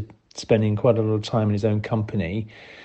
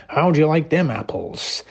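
mock American accent; 5 semitones higher than usual. Pete voicing a dead body for a joke, mock American accent. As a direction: imitate Pete's cheesy American voice for a corpse. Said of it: "saying in an American accent".

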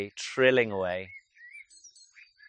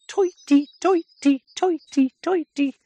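very high, piercing two-note bird chirp; repeated falling chirps, pitched way up; coal tit call imitation; 6 dB louder, slower than usual. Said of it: "it's like he's saying".

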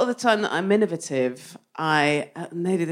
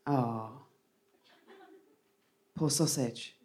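soft pity; 7 semitones lower, 8 dB quieter than usual. Retold or imitated. Pitying thought about the mugger.

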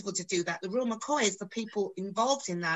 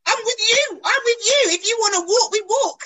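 much higher and louder, excited mock voice of a co-star; Gary's eager backing; 13 semitones higher, 9 dB louder than usual. Co-star backing her against the script, high excited mock voice. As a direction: pump it up like a buddy ready to walk out. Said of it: "He said".

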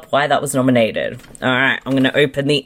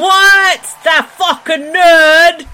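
mock disbelief; 12 semitones higher, 5 dB louder, slower than usual. Incredulous echo of the winner.

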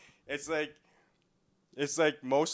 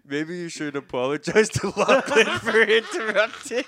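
higher, louder mock imitation of a friend's prim advice; 8 semitones higher, 8 dB louder than usual. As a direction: parrot the friend's words in a teasing put-on voice. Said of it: "you're like".

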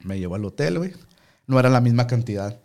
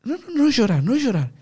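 high-pitched imitated Argentine, with "sh" sounds; loud, high mock Argentine plea; Argentine accent; 14 semitones higher, 4 dB louder than usual. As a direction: act an Argentine urgently forbidding tears. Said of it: "el argentino".

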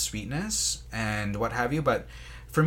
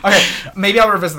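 fast, loud, laughing; laughing it off and moving on; 9 semitones higher, 12 dB louder, much faster than usual. Real, not acted. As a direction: blurt it out still cracking up. Still laughing after the bit.

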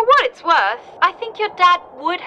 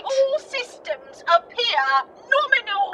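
put-on Cockney accent; 4 semitones higher, 3 dB quieter than usual. Computer replies to the doctor's thanks, in Cockney. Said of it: "why do you sound Cockney".

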